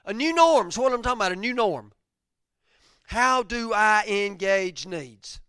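loud, high impassioned preaching; preaching at full force. Real, not acted. Pastor stirring his church, loud and high. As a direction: lift the congregation, voice soaring.

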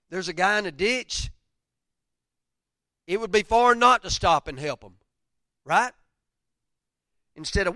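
loud, high impassioned preaching; preaching at full force. Real, not acted. Pastor stirring his church, loud and high. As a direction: lift the congregation, voice soaring.

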